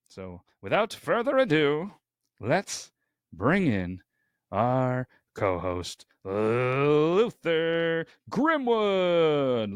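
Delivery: slow, drawn-out ring announcer build; announcer introduction. Real, not acted. Host bringing in co-host Luther, hammed-up announcer. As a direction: stretch the name out high like an announcer.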